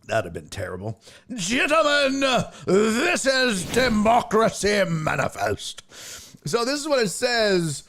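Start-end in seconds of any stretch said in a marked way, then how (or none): 1.3-5.8 high, theatrical mock orator with rolled Rs; hammed-up, booming impression of a pompous arrestee; Jack Carlson. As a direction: proclaim it grandly, drawn out, rolling the Rs. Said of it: "I'm doing this accent".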